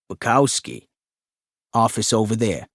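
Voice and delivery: office worker voice. Acted. Office man voiced in story.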